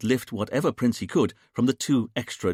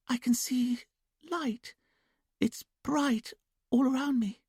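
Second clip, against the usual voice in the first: put-on much higher, slow sing-song child voice; childlike sing-song; slower than usual. A narrator's reading. Julia in trance answering the hypnotist, childlike sing-song. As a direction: sing it softly like a little girl. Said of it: "quiet, sing-song voice".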